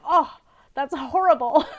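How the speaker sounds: dismayed gasp. Retold or imitated. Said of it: "I'm like".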